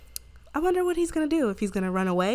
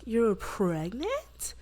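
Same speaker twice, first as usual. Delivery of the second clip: slow, stretched-out disbelief; acted astonished question; incredulous question; 2 semitones higher, much slower than usual. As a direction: draw it out in mock shock. Acted friend reacting to pregnancy news.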